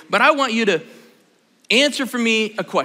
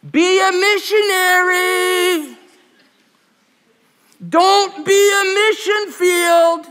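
high, slow Southern Texas drawl calling out; a mother calling out in Southern Texas twang, stretched high; Southern Texas mom voice; 10 semitones higher, 4 dB louder, much slower than usual. Retold or imitated. Mom at school drop-off, Southern Texas voice. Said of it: "in her little Southern Texas voice".

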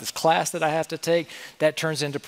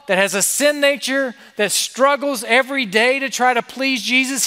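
rising, louder, fervent preaching; full-force preaching; 7 semitones higher, 5 dB louder than usual. Real, not acted. Preacher to students, soaring louder on the coming Savior. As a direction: build the sermon to a soaring peak.

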